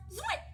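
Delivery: very high, quick zip; sudden high zipping pop; zip sound effect. A narrator's reading. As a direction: flick the word out as a noise. Story sound effect of dressing.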